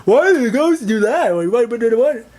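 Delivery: put-on high, loud whiny kid voice; imitating a loud kid. A loud kid in the cinema asking his mom, high and whiny. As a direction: play the pestering child asking questions. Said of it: "asking questions".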